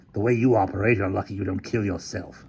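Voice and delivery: understated scoff, near normal pitch; mildly dismissive jab. Acted. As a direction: scoff at his competence. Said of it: "Hamilton scoffed".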